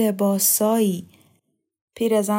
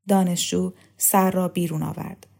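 ordinary delivery, nothing marked

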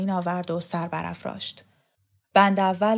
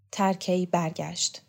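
plain reading voice

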